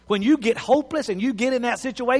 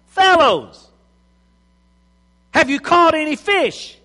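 calling out; 6 semitones higher, 3 dB louder than usual. Acted. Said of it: "He called out".

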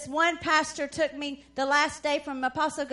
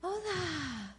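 gentle greeting; 5 semitones higher, 8 dB quieter, slower than usual. Retold or imitated. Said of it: "She turns around and she says".

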